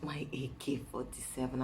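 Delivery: imitated husband, low and soft; soothing. Husband calming his angry wife with pet names, soothing. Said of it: "when he sees I'm angry".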